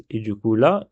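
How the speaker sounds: ordinary talk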